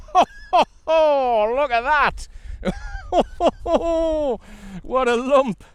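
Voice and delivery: high, laughing, gleeful hoots over a big catch; real thrilled angler's laughter; delighted whoop. Real, not acted. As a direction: cackle with joy at the size of it. Big fish landed, delighted laughing.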